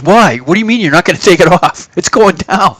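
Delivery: can't believe the customer refuses; baffled broker. Retold or imitated. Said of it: "The guy goes".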